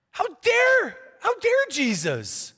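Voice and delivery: high, mock-indignant cry; freaking-out outrage. Panicked disciples finding Jesus napping in the storm, mock outrage. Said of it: "the disciples are freaking out".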